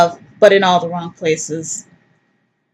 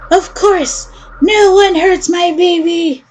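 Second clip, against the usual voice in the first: higher, slow doting mom; higher slow protective mother; 9 semitones higher, slower than usual. Acted. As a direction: promise to protect your baby.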